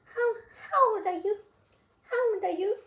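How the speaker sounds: put-on high sing-song baby talk to a pet. Owner cooing at her young ferret, high baby talk.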